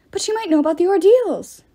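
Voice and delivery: a ghost cries out urgently; crying out. A narrator's reading. Ghost pleads with the pater, urgent cry. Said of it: "another cried".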